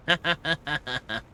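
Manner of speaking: short burst of loud laughter; laughing. Real, not acted. Laughs on finding the item.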